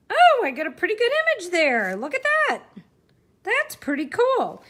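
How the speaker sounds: delighted at how the print came out; excited. Real, not acted. Delighted by the stamped image.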